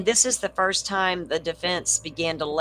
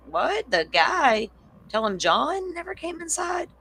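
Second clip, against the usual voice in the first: rising higher with each question, stunned; can't believe what she's reading; incredulous disbelief; 9 semitones higher than usual. Real, not acted. Streamer reacting to trial texts, disbelieving and high.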